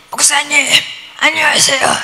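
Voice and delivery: imitating a pastor who has lost their voice; 목이 완전히 쉰 목소리. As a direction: croak out a greeting with a worn-out voice. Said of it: "목소리가 완전히 간 거예요". (완전히 쉰 목소리 흉내)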